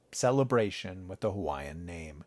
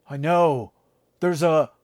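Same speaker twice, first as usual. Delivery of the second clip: louder, higher, suddenly alarmed; alarmed cry; 5 semitones higher, 7 dB louder than usual. Acted. Instructor in the glider noticing the swarm, alarmed. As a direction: blurt it out as the bees arrive. Panic mid-air, bees swarming.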